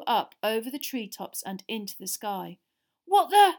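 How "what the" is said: high, loud burst of shock; startled gasp. A narrator's reading. Ron springing to his feet, startled gasp. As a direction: blurt it out in alarm. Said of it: "gasped Ron".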